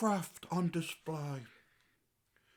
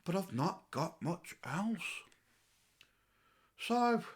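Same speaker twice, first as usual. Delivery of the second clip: ordinary delivery, nothing marked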